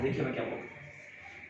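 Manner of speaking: hushed, voiceless aside; whispered aside. Real, not acted. Teacher whispers to students to listen closely.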